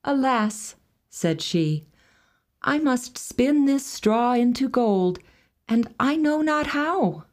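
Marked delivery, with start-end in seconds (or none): 0.1-0.8 plaintive maiden. A narrator's reading. Maiden laments her task. 2.6-7.2 put-on higher, distressed young girl; high maiden voice. A narrator's reading. Maiden answers the little man.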